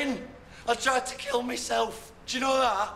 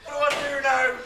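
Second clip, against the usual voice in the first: despairing outburst; 6 semitones higher, 7 dB louder than usual. Acted. Despair after fight.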